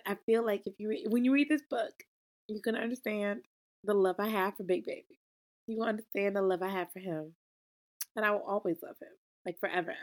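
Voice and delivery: usual speaking voice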